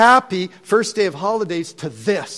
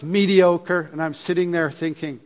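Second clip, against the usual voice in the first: ordinary talk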